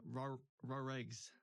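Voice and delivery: dropped R, broad ah vowels; New England accent. Shown as a demonstration. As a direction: say it Boston style, no R's. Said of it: "I know".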